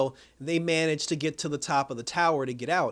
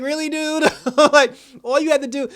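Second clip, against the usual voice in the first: much higher, laughing "really dude"; laughing disbelief; 11 semitones higher, 9 dB louder than usual. Retold or imitated. Fan reacting to the Watcher, laughing in disbelief. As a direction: scoff through a laugh. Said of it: "And I'm just like".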